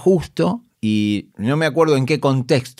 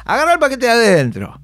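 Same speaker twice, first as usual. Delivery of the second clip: higher, louder 'Agarrá el paquete de adentro'; emboldened delivery; 10 semitones higher, 4 dB louder than usual. Retold or imitated. The actor on stage, emboldened, saying the planted line. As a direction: stride on stage and announce it boldly. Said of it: "vos ya salías envalentonado".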